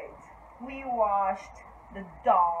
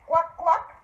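a raised, louder cluck, asked as a wrong guess; questioning mock hen cluck; 5 semitones higher, 5 dB louder than usual. Shown as a demonstration. Teacher to toddlers, trying a wrong animal sound, mock clucking. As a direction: cluck like a hen, testing the kids. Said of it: "the frog doesn't say cluck".

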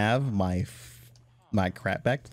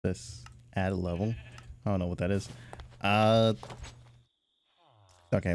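normal talking voice

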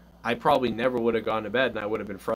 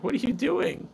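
put-on much higher, puzzled little girl's voice; little daughter. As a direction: squeak it like an amused little girl. Daughter's reaction to being spanked.

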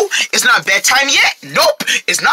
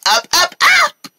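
excited high call; slower than usual. Acted. Urging kids up.

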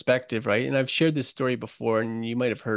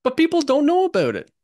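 fired-up emphasis; 8 dB louder than usual. Real, not acted. Passionate point on a podcast.

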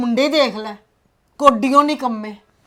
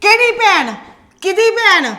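indignant retort; 7 semitones higher, 3 dB louder than usual. Acted. Mother rejects the idea of a sister.

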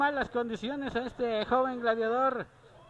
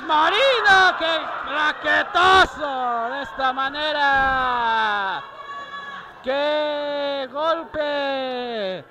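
excited shout; 2 semitones higher, 6 dB louder, much slower than usual. Real, not acted. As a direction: shout out the big hit. Ringside call of a big hit. (grito emocionado)

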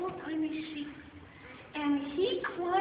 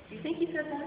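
plain reading voice

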